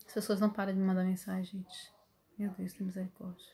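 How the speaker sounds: muttered aside. Real, not acted. Teacher interrupted by phone messages mid-lesson, muttering aside. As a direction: sink into a quiet, exasperated mutter to yourself.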